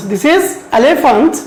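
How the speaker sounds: This is elephant stretched out word by word; slow model reading. Shown as a demonstration. Teacher modelling the word elephant slowly for students. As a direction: drag each syllable of elephant for pupils to copy. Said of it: "can you pronounce it?".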